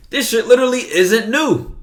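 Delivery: high, loud retold realization; animated reaction. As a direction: exclaim it like a big discovery. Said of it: "I was like".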